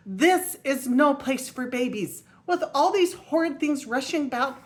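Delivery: high, loud and slow, still upset; mother duck catching her breath, still upset; still flustered. Acted. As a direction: scold the noisy city, still rattled. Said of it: "when she got her breath".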